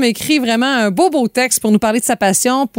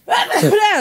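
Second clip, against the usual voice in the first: sneezing; 10 semitones higher than usual. Real, not acted. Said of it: "À vos souhaits".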